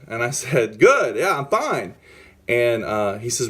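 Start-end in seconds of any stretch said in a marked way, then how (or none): 0.8-1.9 high, punchy comeback; retold testy reply with a raised pitch; aggressive retort. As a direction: throw it back at the manager, pitched up. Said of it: "I'm very aggressive".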